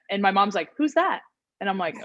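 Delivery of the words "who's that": high-pitched puzzled mom imitation; clueless mom. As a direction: imitate a mom who has no idea.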